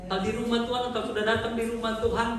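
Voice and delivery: ordinary delivery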